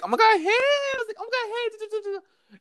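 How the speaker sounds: very high gushing hello; high-pitched sing-song greeting; mock teacher greeting. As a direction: chirp it like a fake-friendly teacher.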